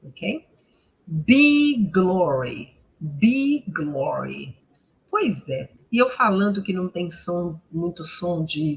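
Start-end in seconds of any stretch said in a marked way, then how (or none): 1.1-4.6 slow "Be glory" with long "ee"; drawn-out model of "Be glory"; long I sound. Shown as a demonstration. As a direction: stretch the vowel in "Be". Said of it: "e um I bem longo".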